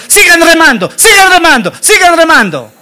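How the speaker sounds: high, insistent repeated command; urgent urging. Acted. Peter urges the others in the boat amid panic, urgent.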